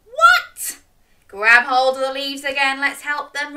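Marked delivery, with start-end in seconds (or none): 0.1-0.8 high, loud disbelieving what; shocked it won't move; surprised. Acted. Storyteller reacting to the stuck turnip, astonished.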